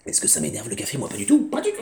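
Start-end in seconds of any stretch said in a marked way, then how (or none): 1.0-1.5 mock denial. 1.5-1.8 high squeak of denial; squeaky. Pretend.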